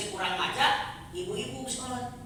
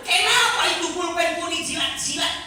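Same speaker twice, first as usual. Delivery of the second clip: higher and louder scolding teacher; the teacher scolding a child, higher and louder; teacher's voice; 8 semitones higher, 8 dB louder than usual. Retold or imitated. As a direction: scold the kids like the Batak teacher. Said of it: "Cek guru".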